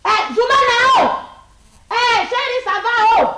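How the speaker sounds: high, slow, loud wailing call; mock mourning cry. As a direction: call out to the dead husband in grief. Wife calls to dead husband. (appel de deuil aigu et lent)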